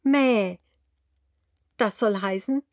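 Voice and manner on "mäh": higher, louder bleat in reply; sheep bleat. A narrator's reading. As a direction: answer the piglet with a bleat. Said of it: "Das Schaf antwortet".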